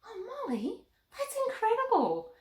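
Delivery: host gushes over Molly's Lego build; excited praise. Acted. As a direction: marvel at the puppet's work. Praising the puppet.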